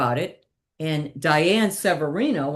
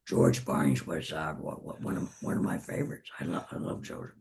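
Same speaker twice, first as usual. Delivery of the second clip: put-on very low, gravel-dry old man voice, much softer; George Burns impression; 12 semitones lower, 9 dB quieter than usual. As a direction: drop deep into a croaky George Burns. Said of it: "And of course, George Barnes".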